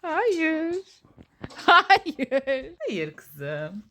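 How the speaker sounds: high, loud playful no; talking to a dog. Real, not acted. Playing tug with her pet. (köpekle oynarken tiz sesle)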